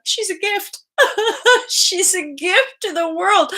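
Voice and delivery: high, tearful retold gushing; hammed-up happy sobbing; imitated weeping. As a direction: squeak it out as if bawling. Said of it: "watching myself crying".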